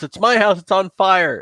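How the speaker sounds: high-pitched cry of a homeowner; alarmed homeowner. Acted. Homeowner tells the arriving firemen of the blaze, alarmed and high. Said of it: "the guy who owns the house".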